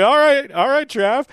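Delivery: high and loud "all right, all right"; amused agreement. Retold or imitated. Answering a friend's joke about bombs, high and loud.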